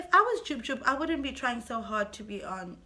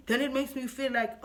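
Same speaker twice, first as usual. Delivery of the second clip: usual speaking voice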